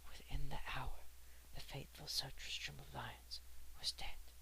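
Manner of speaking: ordinary delivery, nothing marked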